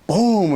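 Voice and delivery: punchy storytelling boom; imitated kick. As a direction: mimic a hard kick on a door.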